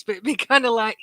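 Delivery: ordinary talk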